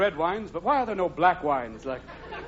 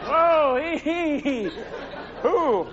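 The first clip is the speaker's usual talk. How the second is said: animal voices; 7 semitones higher, slower than usual. Acted. Animals teasing the coat owner, high squealing voices. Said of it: "the animals will be going".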